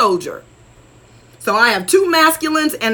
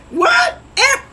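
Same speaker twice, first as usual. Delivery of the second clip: a sudden, much higher disbelieving what; surprised what; 13 semitones higher than usual. Real, not acted. Answering a follower's Wakanda question with sudden surprise. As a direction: shoot the word up in sheer disbelief.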